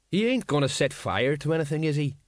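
mildly worried sergeant's question; anxious. A narrator's reading. Sergeant asking about the dragon, mildly anxious. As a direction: ask with a touch of unease. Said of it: "said the sergeant anxiously".

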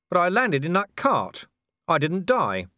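high, urgent pleading; agitated. Acted. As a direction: press the point urgently. Man arguing with Death.

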